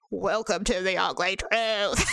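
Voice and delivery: low, slow husky-woman parody; Kathleen Turner. Retold or imitated. Joking to her sister about ageing, mock deep voice. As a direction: drag it out in a deep, aged voice. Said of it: "don't wanna sound like Kathleen Turner".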